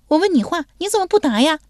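put-on high, lively girlish voice; young woman's voice. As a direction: play the bold young lady teasing him. Young woman presses him.

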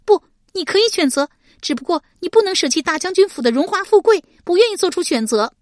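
high, loud furious shout; angry accusation. Acted. Shi Pei angrily accusing Deng Yi, shouting. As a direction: tear into his excuses.

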